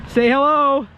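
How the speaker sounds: high, loud coaxing; coaxing call. Acted. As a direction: urge the bird to talk. Coaxing a bird to speak.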